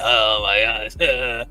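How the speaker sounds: slow, low drawn-out groan of disgust; exasperated. Acted. Streamer recoiling from people coughing, slow low disgust. Reacting to sick voice.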